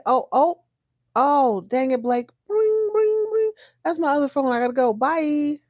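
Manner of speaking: high, hammed-up fake ring ring; fake excuse. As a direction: ham up a phony phone excuse. Billy fakes phone excuse.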